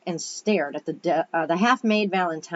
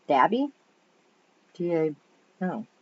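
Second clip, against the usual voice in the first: usual speaking voice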